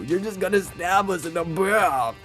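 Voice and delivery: ordinary delivery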